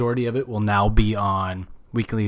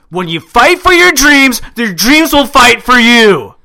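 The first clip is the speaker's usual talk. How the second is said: big booming motivational quote; wrestling promo; 11 semitones higher, 10 dB louder than usual. Retold or imitated. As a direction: shout it like an inspirational poster. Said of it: "somebody told me".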